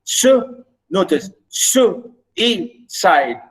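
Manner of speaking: "suicide" pulled apart slowly, a pause after each syllable; slow teacher's breakdown of "suicide". Shown as a demonstration. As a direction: isolate su, then give su, i, cide. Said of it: "Notice the three syllables".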